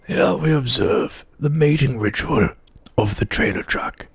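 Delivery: a hushed, lightly voiced Attenborough; softly narrate, fading at the end; nature documentary narrator. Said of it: "an impression very well of ... Sir David Attenborough".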